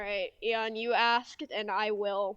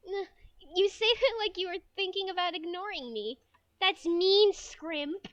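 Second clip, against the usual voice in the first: put-on high, hurt girl voice; anime schoolgirl, complaining; 9 semitones higher, 7 dB louder than usual. Friend objects to being ignored, high anime girl complaint. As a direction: protest high and loud as the slighted friend.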